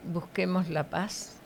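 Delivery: ordinary talk, nothing marked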